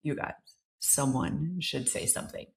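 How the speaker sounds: hushed. Retold or imitated. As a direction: murmur it like a secret. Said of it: "someone says this".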